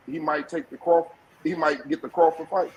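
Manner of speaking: ordinary delivery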